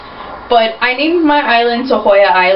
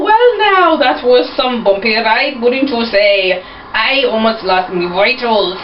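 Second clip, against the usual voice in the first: Irish pirate voice. Acted. Irish pirate captain asks the passenger about the bumpy ride, in a faint Irish lilt. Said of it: "he's more Irish".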